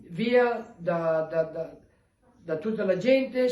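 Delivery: usual speaking voice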